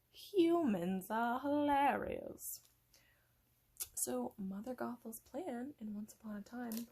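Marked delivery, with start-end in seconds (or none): 0.1-2.6 slow, loud, higher menacing villain. Acted. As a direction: gloat slowly like a Disney villain. Mock villain monologue.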